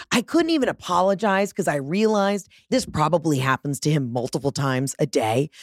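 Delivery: usual speaking voice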